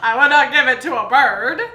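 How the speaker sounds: loud, laughing echo; laughing imitation. Cook echoing co-host's scone joke, laughing imitation. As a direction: parrot it loudly through laughter.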